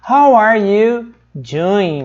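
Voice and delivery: how are you doing, very slow and drawn out; slow demonstration. Shown as a demonstration. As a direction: stretch each word for learners. Teacher models phrase word by word. (bem devagar, palavra por palavra)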